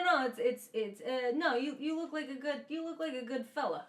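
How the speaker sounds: ordinary talk, nothing marked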